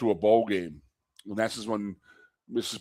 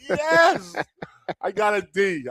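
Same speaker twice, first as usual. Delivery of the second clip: high triumphant yes; 10 semitones higher, 4 dB louder, slower than usual. Retold or imitated. Player celebrating his D with the coach, pitched-up cheer. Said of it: "And I was like".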